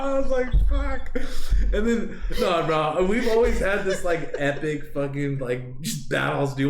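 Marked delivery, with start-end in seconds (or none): 0.7-1.2 high, louder yelped curse; mock pained fuck. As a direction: yelp it like it hurts. Beaten up in his bed.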